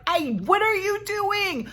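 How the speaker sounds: high, pleading wife's voice. Retold or imitated. Hermione to her accusing husband, high and pleading. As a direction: beg him as his wronged wife. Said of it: "And Hermione's like".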